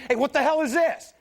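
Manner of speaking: loud, high-pitched outburst; indignant shout, pitch jumping up; angry driver. Acted. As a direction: yell at the guy who cut you off. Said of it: "he's pissed off at you".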